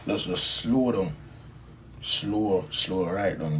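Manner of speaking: drawn-out, slowing words; slowed down. Retold or imitated. Performer recalling the world slowing after the stage, slowed down. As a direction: stretch out "slow" as if time drags.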